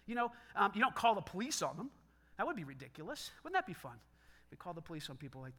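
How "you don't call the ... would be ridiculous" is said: ordinary delivery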